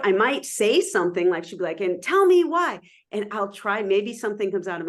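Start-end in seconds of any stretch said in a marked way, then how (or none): 1.8-2.8 high, loud pretend demand; hammed-up scolding, pitched way up; mock yelling. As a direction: demand it like a cross colleague, play-acted. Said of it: "she yells at me".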